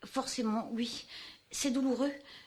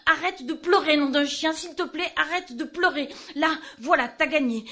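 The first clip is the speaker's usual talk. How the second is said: exasperated scolding; 4 semitones higher, 7 dB louder than usual. Acted. Scolding a crying companion.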